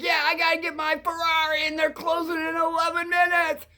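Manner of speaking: a customer shouting at the cab driver, high and frantic; excited yelling customer. Retold or imitated. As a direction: play the frantic man racing to his Ferrari.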